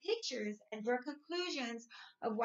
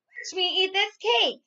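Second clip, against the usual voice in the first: a loud, higher boastful yell in a lizard's voice; 7 semitones higher, 8 dB louder than usual. Acted. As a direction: yell it proudly like a show-off winner. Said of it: "he yells".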